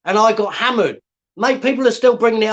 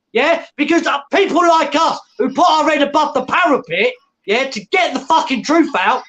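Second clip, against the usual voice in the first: furious higher yelling tirade; angry rant; 6 semitones higher than usual. Real, not acted. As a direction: tear into the lazy keyboard warriors at full volume. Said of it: "Sorry about that".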